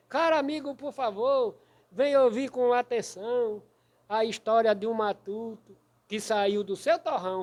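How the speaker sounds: slow, rhythmic cordel reading, beating the syllables; galloping verse recitation. Shown as a demonstration. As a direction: bounce through the rhyme like a galloping horse. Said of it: "é porque você lê saltando". (declamação de cordel, martelando as sílabas)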